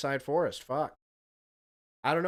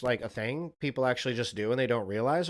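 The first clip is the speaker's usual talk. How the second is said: usual speaking voice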